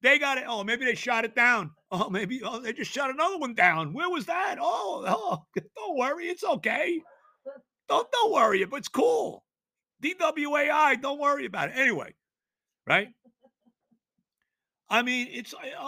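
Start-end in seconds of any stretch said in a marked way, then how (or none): 3.9-11.8 high, breezy mock reassurance; sarcastic mock calm. Fan mocking league's indifference in a high sarcastic voice. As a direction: brush it off sarcastically, pitched high. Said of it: "mock reassurance, sarcastic".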